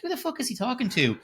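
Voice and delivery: high-pitched baffled question; confused outrage. Retold or imitated. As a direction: squeak it out, utterly lost. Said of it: "It's like".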